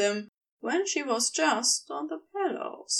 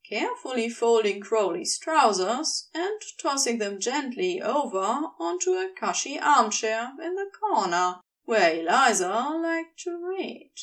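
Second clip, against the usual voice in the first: ordinary delivery, nothing marked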